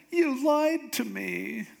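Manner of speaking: high, drawn-out hurt whine; mock tearful accusation. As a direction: act wounded and accuse him, stretched out. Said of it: "crying even more".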